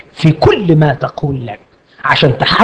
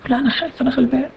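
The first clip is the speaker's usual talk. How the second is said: صوت أعلى وأخفض بكثير كأنه خائف مسكين؛ قلها بخجل وضعف يكاد يهمس؛ بولس يجيب بخوف وتواضع بصوت خافت; timid Paul; 7 semitones higher, 9 dB quieter, much faster than usual. Acted.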